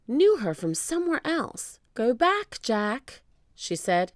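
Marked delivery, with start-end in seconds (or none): 1.9-3.2 higher, human-sounding voice without screech; person-like parrot. A narrator's reading. Polly urging Jack home, sounding like a person. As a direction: speak the warning like a person. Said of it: "She sounded like a person".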